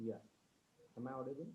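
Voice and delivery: faint voice far from the mic; speaking too softly to hear; barely audible. Real, not acted. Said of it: "Sir, it's very low".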